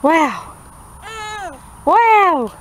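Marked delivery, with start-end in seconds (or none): 1.8-2.5 excited wow. Shown as a demonstration. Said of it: "Say wow".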